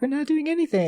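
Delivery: high-pitched imitation of protesting bullies; mock innocent protest.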